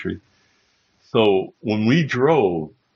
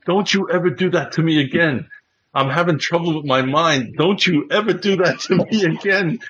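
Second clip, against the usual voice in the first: mock pleading scold; 8 semitones higher than usual. [name] scolding after prank.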